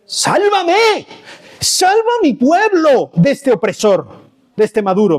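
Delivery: high cry for deliverance; impassioned plea. Acted. Preacher voicing Venezuelans under an oppressor, impassioned pleading. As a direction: call out for rescue, pitched well up.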